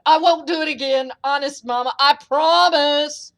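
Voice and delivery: hammed-up promise to mama; whimpering giant. A narrator's reading. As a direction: plead like a scolded overgrown child. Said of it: "whimpered the giant".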